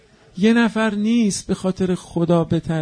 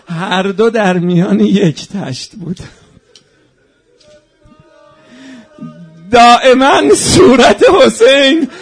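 loud, high mourning shout; preacher's lamenting outcry; wailing shout; 8 semitones higher, 13 dB louder than usual. Acted. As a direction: cry it out at full force. Rowzeh climax, head in basin.

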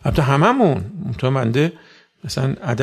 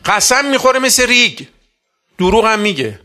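much higher, louder preaching cry; impassioned outcry; 8 semitones higher, 6 dB louder than usual. Real, not acted. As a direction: cry out in dismay at the change. Preacher decrying corrupted youth.

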